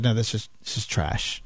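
low, quiet flat dismissal; dismissive quote. Retold or imitated. Studio head rejecting a pitch, low curt dismissal. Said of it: "Kathleen Kennedy said".

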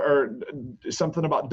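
normal talking voice

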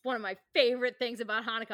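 ordinary talk

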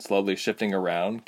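plain reading voice

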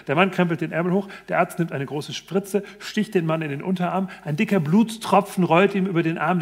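usual speaking voice

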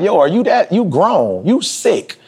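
high, appalled retort; retold indignant rant, pitched high; outraged disbelief. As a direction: throw it back at them, stunned. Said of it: "I'm like".

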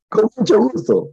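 eager delight. Retold or imitated. Repeated delighted.

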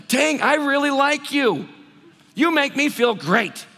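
high, loud and slow, delighted; gushing delight. Retold or imitated. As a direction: pour out how much you like him. Gushing at new friend.

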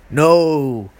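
ordinary delivery

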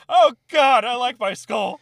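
high, loud comic panic; mock panicked yell. Retelling fleeing a sergeant with a sledgehammer, mock panicked yell. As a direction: cry out in hammed-up terror.

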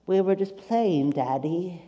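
put-on small, slow British little-boy plea; pitiful British kid voice, trailing off; mock British child. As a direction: play a tearful British child, softly. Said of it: "they turned British right then".

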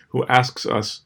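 ordinary delivery, nothing marked

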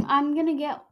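ordinary delivery

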